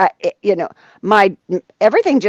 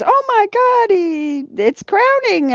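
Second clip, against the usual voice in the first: very high, drawn-out mock alarm; imitated shock; slower than usual. Nurse discovering the birth, high mock shock. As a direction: mimic a nurse startled by the sight.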